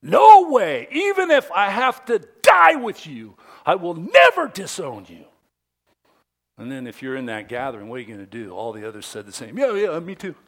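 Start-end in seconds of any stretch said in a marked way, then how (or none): 0.0-5.3 emphatic high shout; foot-stomping, fist-pounding insistence at full volume; Peter. Acted. Peter insisting he will never disown Jesus, emphatic shout. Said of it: "that's what emphatic means". 9.6-10.4 high, loud me-too chiming in; hasty agreement. Acted. The others echoing Peter at the gathering, hasty agreement.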